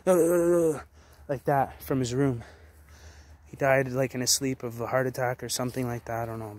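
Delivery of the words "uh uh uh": short strained groans, higher and louder; imitated dying groan. Imitating the neighbour dying in his sleep, strained groans. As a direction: mimic the neighbour's death cry. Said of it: "I heard a scream almost like a".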